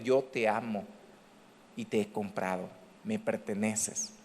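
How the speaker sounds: low, soft, tender assurance; God's gentle voice. Acted. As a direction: speak softly, close to the listener's ear. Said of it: "Dios siempre te va a hablar con una voz suave".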